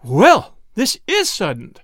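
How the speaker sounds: loud "well", then astonished; taken-aback exclamation. A narrator's reading. Mother drops into a chair, astonished exclamation. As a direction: exclaim it, quite bowled over. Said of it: "exclaimed Missus Roloff".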